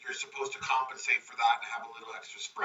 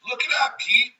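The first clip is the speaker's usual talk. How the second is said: much higher, proud and bright; excited boast; 9 semitones higher, 8 dB louder than usual. Retold or imitated. Builder proudly telling Pete to look, excited and much higher. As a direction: show it off like a win. Bob boasts under budget.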